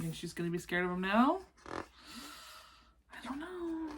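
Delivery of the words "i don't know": soft doubtful aside. Real, not acted. Reader playful aside.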